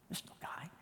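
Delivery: whispered under the breath; dismissive whisper. Acted. Husband answering his wife's alarm, dismissive whisper. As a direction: whisper it back, very softly.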